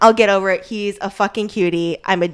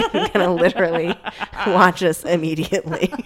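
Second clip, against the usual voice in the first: laughing. Real, not acted. Laughing at own eagerness.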